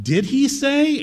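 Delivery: high, slow insinuating "Did he say?"; mock serpent voice. Preacher voicing Satan questioning God's word. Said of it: "So he starts out".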